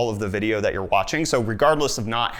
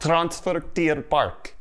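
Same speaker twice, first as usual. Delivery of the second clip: slow, higher try at Afrikaans; attempted Afrikaans accent; 5 semitones higher, much slower than usual. Repeating the park name after a friend, attempted Afrikaans accent. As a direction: copy an Afrikaans accent, slowly and carefully. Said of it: "I don't got the Afrikaans accent".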